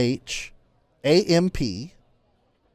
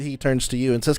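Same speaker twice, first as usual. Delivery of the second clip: ordinary delivery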